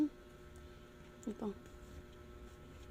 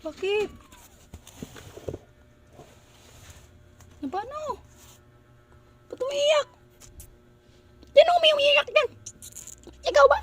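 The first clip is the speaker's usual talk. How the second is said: very high, louder coo to the kitten; sky-high pet voice, rising louder; 8 dB louder than usual. Real, not acted. Cooing at a crying kitten.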